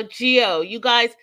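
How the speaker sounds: normal talking voice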